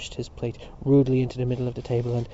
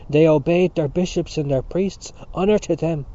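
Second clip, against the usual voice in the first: loud, raised, defiant defence; crying out; 4 semitones higher, 7 dB louder than usual. A narrator's reading. Dante standing up for bishops and priests, crying out. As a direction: proclaim it forcefully. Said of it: "cried Dante".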